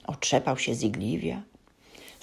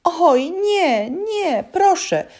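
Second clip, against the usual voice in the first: high, loud, whining elf voice; timid elf begging in a squeaky high voice; whimpering little voice; 13 semitones higher, 6 dB louder, much slower than usual. A narrator's reading. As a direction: plead like a tiny frightened elf. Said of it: "odezwał się znów pojękujący głosik".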